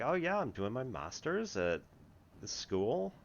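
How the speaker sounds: put-on a touch of a cheerful California girl; chipper girl. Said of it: "this very chipper kinda California girl".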